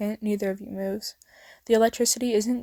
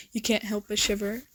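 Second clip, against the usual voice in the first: usual speaking voice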